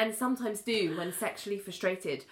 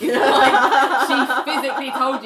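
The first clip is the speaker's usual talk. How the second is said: loud laughing disbelief; laughing at a character's denial; laughing exasperation; 5 semitones higher, 13 dB louder, slower than usual. Real, not acted. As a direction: laugh it out, outraged.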